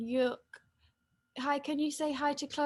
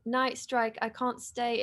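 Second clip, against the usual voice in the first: ordinary talk, nothing marked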